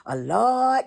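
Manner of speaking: high, drawn-out imitation of a church prayer; lightly hammed-up preacher praying aloud; mock praying voice. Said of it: "And you be like".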